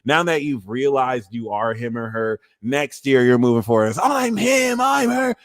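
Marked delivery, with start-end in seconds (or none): 4.0-5.3 high-pitched self-hyping declaration; proud proclamation. Acted. You moving forward next year, proud proclamation. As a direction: crow it in a high voice. Acting out newfound self-belief.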